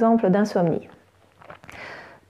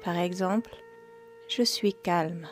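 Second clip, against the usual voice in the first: guided meditation; 9 dB quieter than usual. Acted. Yoga teacher guiding listeners into yoga nidra, calm meditation voice.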